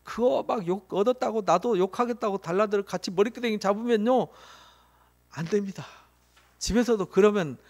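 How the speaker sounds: usual speaking voice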